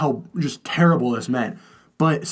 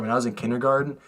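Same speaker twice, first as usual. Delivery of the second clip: ordinary talk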